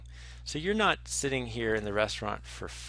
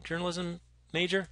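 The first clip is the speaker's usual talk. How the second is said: higher, lighter casual question; his question retried; 7 semitones higher than usual. Retold or imitated. Bill Murray retrying the question on his date, lighter. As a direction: ask it again smoothly this time. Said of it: "this time things go different".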